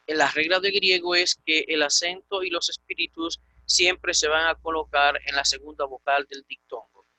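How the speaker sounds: ordinary talk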